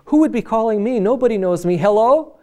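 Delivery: Jim's puzzled voice. Retold or imitated. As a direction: sound baffled that anyone would call. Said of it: "I heard this voice saying".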